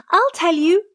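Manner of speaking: high, loud little cry; calling out. A narrator's reading. Tiny Tom pipes up to the passing thieves, crying out high. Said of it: "cried Tom".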